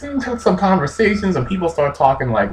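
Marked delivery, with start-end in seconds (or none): none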